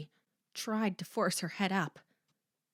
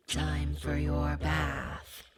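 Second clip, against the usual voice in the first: low, slow husky menace; 4 semitones lower, much slower than usual. Acted. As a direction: drawl it huskily and slowly. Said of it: "the husky voice said".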